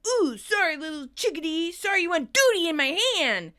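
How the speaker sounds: high, loud sarcastic apology; still yelling. Acted. Tommy to the chick, sarcastic yelling. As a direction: sneer a mock apology loudly. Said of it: "Tommy, still yelling".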